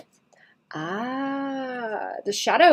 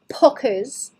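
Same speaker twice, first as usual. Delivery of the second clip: ordinary talk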